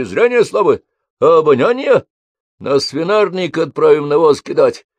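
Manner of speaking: loud, jeering sergeant voice; mock the sick recruits loudly. A narrator's reading. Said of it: "изгалялись".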